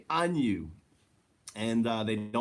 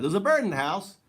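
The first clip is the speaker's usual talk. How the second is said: replaying the startled cry, higher and louder, with a rising end; retold surprised exclamation; 9 semitones higher, 5 dB louder, faster than usual. Insisting to viewers those were his words, surprised cry. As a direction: echo the moment you spotted the bird, still amazed. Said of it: "Literally, I said that".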